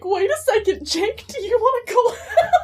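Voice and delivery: high, loud, laughing mock-eager plea; suddenly keen, voice shooting up; frantic backpedal. Teen backpedalling to ask crush out, mock-eager. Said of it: "backpedal and be like".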